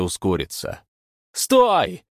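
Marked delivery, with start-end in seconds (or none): 1.3-2.0 high, loud yell; shout. A narrator's reading. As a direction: yell at the runner to stop. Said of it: "крикнул Стас".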